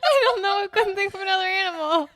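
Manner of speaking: high, loud, fast words through laughter; laughing defence. Real, not acted. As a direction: protest helplessly while cracking up. Defending silly lyric while laughing.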